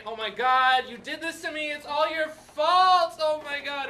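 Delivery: high, loud hammed-up screaming wife; imitating a screaming woman in labour; mock screaming. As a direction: shriek the blame at him, mock-hysterical. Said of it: "she's screaming".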